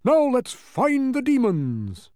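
high, loud, drawn-out battle cry; rally them with blustering bravado; hammed-up blustering bug; fierce cry. A narrator's reading. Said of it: "cried the humbug".

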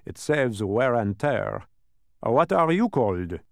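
a pedantic many-faced figure; dodecahedron voice. A narrator's reading.

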